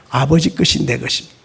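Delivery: ordinary talk, nothing marked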